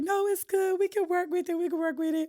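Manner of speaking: very high, coaxing "we can work with it"; mock cheerful producers. Singer imitating producers coaxing her, high mock cheer. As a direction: voice them sweet and pushy.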